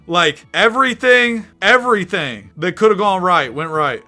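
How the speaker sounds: high, loud disbelief; exasperated protest. Real, not acted. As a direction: protest an unfair result. Protests losing despite perfect luck.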